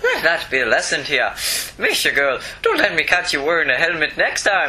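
performed Irish police chief accent. Shown as a demonstration. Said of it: "Can you do an Irish accent?".

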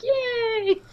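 high, drawn-out mock "yay"; gleeful cheer. Host imitates her friend cheering the villain's return, gleeful. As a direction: imitate a delighted squeal.

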